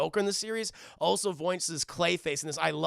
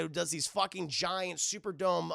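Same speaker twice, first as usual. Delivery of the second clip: ordinary talk, nothing marked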